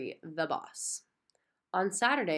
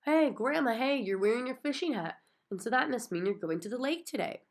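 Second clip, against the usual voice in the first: excited; 6 semitones higher than usual. A narrator's reading. Excited child greeting grandma.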